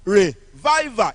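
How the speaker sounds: "Revival" slow and loud, VI stressed; slow, emphatic "Revival" with weight on VI; second-syllable stress. Shown as a demonstration. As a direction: hit the middle of "Revival". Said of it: "As I pronounce them".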